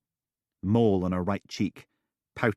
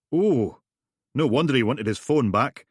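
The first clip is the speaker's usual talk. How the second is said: high, loud knowing tone; Steele amused; 6 semitones higher, 6 dB louder than usual. Acted. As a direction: savour the discovery. Steele leering.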